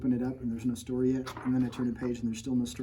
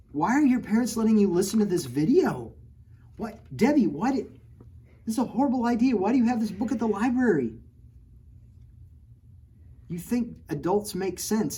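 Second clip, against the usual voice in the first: much higher and louder, incredulous; mock exasperation; 8 semitones higher, 7 dB louder than usual. Real, not acted. Author scolding parents and librarian in fun. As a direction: throw up your hands in disbelief.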